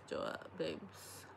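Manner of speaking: breathy whispered echo, slow. Real, not acted. Vlogger to viewers about having no boyfriend, slow whisper. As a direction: say it again under the breath, almost silent.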